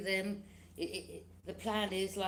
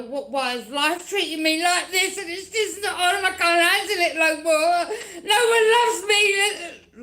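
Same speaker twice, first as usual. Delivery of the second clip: high, loud hammed-up wail, ending slow and sung; mock wailing; 9 semitones higher, 14 dB louder than usual. Mocking the defeated enemies' self-pity, hammed-up wailing. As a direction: sob it out like a ham.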